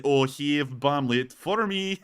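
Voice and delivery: slow, put-on Russian accent; mock Russian accent. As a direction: play a Russian soldier in broken English. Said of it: "He's like".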